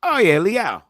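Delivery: normal talking voice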